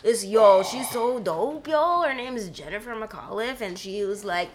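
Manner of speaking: put-on Toronto slang, laid-back Scarborough accent. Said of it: "in my Scarborough accent".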